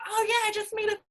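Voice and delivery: high, breezy boast; mock bragging. Coach imitating a bragging coach, high and breezy. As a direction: brag like a showy coach. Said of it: "you're like".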